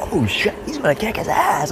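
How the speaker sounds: high, excited exclamation; excited retold yell. Friend cheering the chase, high and excited. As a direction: hype it up like a spectator.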